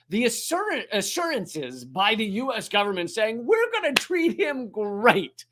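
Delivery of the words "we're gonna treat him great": high, drawn-out mock British accent. US government promising good treatment, in a mock British accent. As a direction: put on a lofty British accent, much higher and slower. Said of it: "giving them a British accent".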